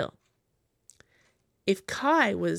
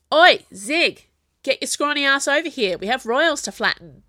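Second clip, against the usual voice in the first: loud, higher shout across the pitch; yelling; 6 semitones higher, 8 dB louder than usual. A narrator's reading. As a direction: call her over with a big yell. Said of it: "yelled Corporal Jack".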